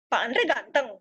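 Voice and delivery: questioning swing in pitch on "ganteng". Shown as a demonstration. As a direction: ask it in doubt about "ganteng". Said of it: "ada yang pertanyaan".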